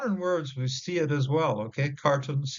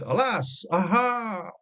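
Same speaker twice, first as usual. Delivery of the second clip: anguished cry; 10 semitones higher, slower than usual. Acted. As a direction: cry out "aha" in sudden dread. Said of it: "onomatopoeia here, sounds just like it's aha".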